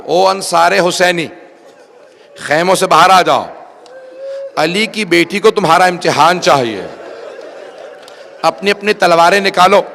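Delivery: loud rallying call to the tents; a battle-cry summons from the tents; calling out to the companions. Acted. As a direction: summon the men out with a ringing shout. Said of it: "جناب حبیب نے آواز دی".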